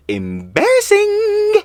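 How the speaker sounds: high, loud, drawn-out sing-song mock. Real, not acted. Mocking her retraction, drawn-out high taunt.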